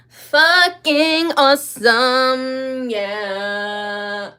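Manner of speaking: slow, high, loud drawn-out stoner voice. Retold or imitated. Streamer imitates a rapper's slow talk, drawn out. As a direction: stretch it out like a laid-back rapper. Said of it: "That was my [name] impression".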